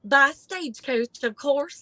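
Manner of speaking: lilting, drawn-out Southern voice; little Southern belle. Retold or imitated. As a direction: imitate a Southern belle stating the obvious. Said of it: "little Southern little sc".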